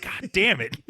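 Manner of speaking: high-pitched retold cursing; mock exasperation. Guest learning it is Benny's house, mock exasperation. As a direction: curse in playful exasperation.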